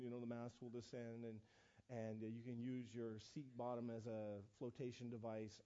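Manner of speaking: low, soft safety-announcement voice; flight attendant drone. Retold or imitated. Mocking the flight attendant's safety talk, low monotone drone. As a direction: drone through it like the cabin briefing.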